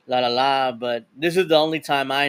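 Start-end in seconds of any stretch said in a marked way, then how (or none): none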